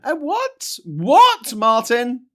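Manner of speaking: high, loud mock indignation; mock outrage. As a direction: play offended at the insult, squeaky and loud. Mock outrage at Bootle insult.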